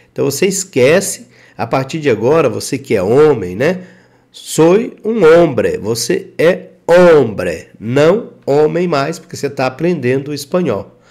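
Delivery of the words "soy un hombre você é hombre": slow, hombre said obre with silent h; teacher demo, hombre with mute h; Spanish model sentence. Shown as a demonstration. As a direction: spell out hombre slowly for learners. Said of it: "o H aqui é mudo".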